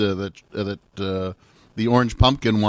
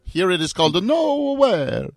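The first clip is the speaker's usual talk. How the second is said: put-on Italian accent; 9 semitones higher than usual. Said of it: "Italian accent".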